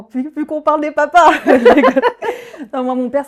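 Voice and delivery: loud, high burst through laughter; laughing. Real, not acted. Guest joking to host about talking of dads, laughing hard. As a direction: crack up while talking, real laughter.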